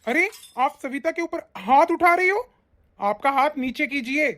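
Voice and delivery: high-pitched, alarmed objection; shocked intervention. Acted. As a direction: step in to stop a blow. Son stops mother raising her hand.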